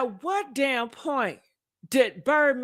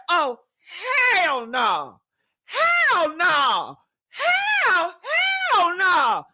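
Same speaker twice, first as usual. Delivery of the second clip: retold outburst; 5 semitones higher, much slower than usual. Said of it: "I said".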